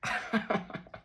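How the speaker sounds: a high, bursting real laugh. Real, not acted. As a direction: crack up out loud. Cracking up at son's remark.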